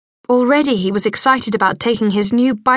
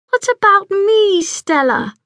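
sad lisping; 10 semitones higher than usual. A narrator's reading. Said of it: "lisping in a sad tone".

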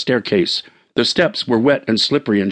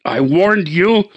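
cried warning; 7 semitones higher, slower than usual. Acted. Husband warns the Indian before leaping, slow cry. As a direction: drag out the threat. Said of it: "he cried".

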